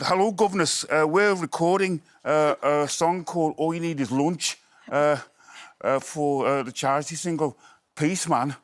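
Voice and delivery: mock Scouse Lennon greeting; [name] impression. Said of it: "I was talking like".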